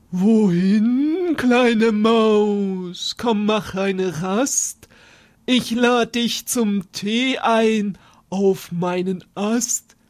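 lightly voiced owl, inviting; hooting owl. Owl inviting the mouse to tea, lightly voiced.

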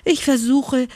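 high, breathless hare's voice; a gasping, high little hare. A narrator's reading. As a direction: gasp it out like a tired little hare. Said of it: "japste der Hase".

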